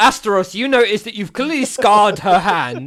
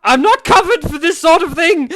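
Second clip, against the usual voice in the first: higher, pleading, slowing down; anxious shopkeeper; 6 semitones higher than usual. Acted. Shopkeeper begging the customers not to touch, high and anxious. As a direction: warn them off nervously. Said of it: "just like".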